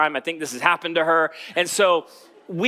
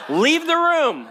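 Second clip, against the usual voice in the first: sharp command; 10 semitones higher, 3 dB louder, much slower than usual. Retold or imitated. Dad ordering squeamish wife out, higher and louder. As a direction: leave the room, said higher and louder. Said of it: "I'm like".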